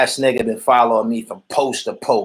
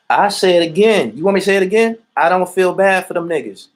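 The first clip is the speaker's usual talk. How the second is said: raised voice, daring them to ask again; defiant and loud; 7 semitones higher, 4 dB louder than usual. Real, not acted. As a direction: hit it hard, defiant, like a challenge. Defiant repeat to commenter.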